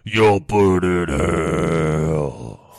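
deep, slow demonic announcer; demonic game voice. Pretend. Video game villain voice.

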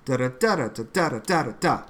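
fast even da da run matching the line; scansion in da syllables. Shown as a demonstration. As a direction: hum out the meter briskly. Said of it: "It's very musical".

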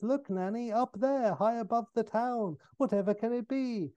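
put-on high-pitched child's voice; little girl. Little girl to her nanny, high childlike wonder. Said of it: "A little girl runs up to the nanny".

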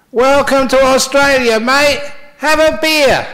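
loud, high, hearty shout of greeting; big high-pitched holler to a newcomer; shouted welcome. Retold or imitated. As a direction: call it out at full volume like an Aussie fisherman. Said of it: "shouted out to him".

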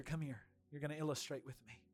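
normal talking voice

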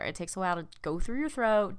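usual speaking voice

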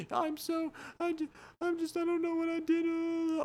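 very high, broken sobbing voice; weeping robber. Acted. Robber breaks down after his mom's plea, high and sobbing. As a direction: whimper it out like a man falling apart.

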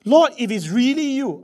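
high, loud appeal; Peter calling out. Acted. Acts Peter calling to Jesus.

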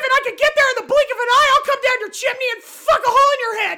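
loud, rushed shouted threat; enraged threat. Acted. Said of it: "so consumed with rage".

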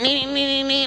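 high, insistent beeping noise; imitated car horns. People honking at a stopped driver, high imitated beeps. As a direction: mimic honking horns on one high note. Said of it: "and people, like".

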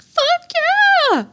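high, shrieked victory yelp; a kid's triumphant squeal; gleeful cheer. Retold or imitated. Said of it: "and she's like".

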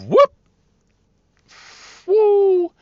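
high, loud "woo" of someone sliding; slipping whoop. Retold or imitated. Driver imitates woman slipping outside, high whooping. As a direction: whoop it up like a skater losing footing.

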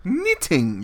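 high, loud mock English accent. Shown as a demonstration. Correcting the reader's accent, exaggerated English accent. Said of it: "You gotta do it in the accent".

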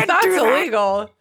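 high, loud mock outrage; protesting Fudge. Acting out Fudge objecting to the port key, loud protest. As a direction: object in a hammed-up wail.

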